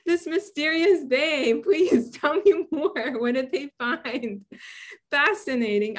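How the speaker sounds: slow, drawn-out sarcastic curiosity; mock fascination. Scientist humoring someone explaining her own research to her, in mock fascination. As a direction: feign wide-eyed interest with a laugh in it.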